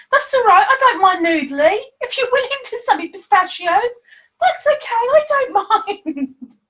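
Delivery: high, giggly, laughing through it; laughing reassurance. Real, not acted. Reassuring the pistachio seller, laughing and high. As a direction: reassure them while giggling.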